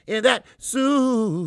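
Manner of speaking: loud, high, long drawn-out whoop; hyped holler. Real, not acted. Host greeting a viewer in the chat, hooting loudly. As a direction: stretch the last word into a big hoot.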